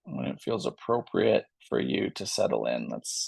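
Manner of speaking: calm, unhurried yoga cue voice; a soothing yoga teacher easing students into stillness; gentle yoga instruction. Real, not acted. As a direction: guide the class softly into rest.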